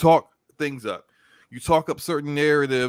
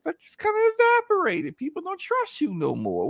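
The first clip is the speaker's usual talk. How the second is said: much higher mocking tone; talking about a writer's lost trust, high mocking. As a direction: lift the voice way up to mock.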